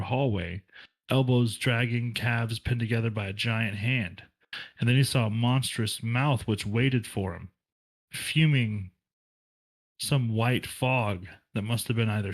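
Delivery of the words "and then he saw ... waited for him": ordinary delivery, nothing marked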